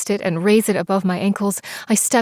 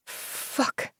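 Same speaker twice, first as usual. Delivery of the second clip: breathless unvoiced curse under the breath; muttered curse; 11 dB quieter than usual. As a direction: swear to yourself, barely aloud. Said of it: "I muttered".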